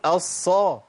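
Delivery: الصا with the ص clearly held double; doubled sad. Shown as a demonstration. Teacher correcting the mistake, doubled sad held clearly. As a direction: lean firmly on both ص sounds in الصا. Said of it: "لازم أقول".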